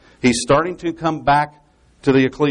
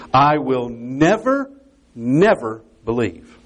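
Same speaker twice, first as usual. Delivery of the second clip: very slow, stressed "never", pause, "never" once more; emphatic double never; slower than usual. Shown as a demonstration. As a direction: draw out each "never", with a pause between the two. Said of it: "It's an emphatic negative".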